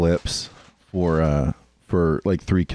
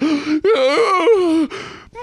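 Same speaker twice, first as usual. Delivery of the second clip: loud, high breathy gasp; 4 dB louder than usual. Retold or imitated. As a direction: gasp like a thrilled woman. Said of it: "really breathy, sexy lady".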